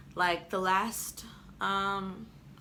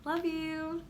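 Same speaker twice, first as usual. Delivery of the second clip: very high, loud cooing voice; 10 semitones higher, 9 dB louder, much slower than usual. Real, not acted. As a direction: coo like greeting a pet. Cooing at someone off camera.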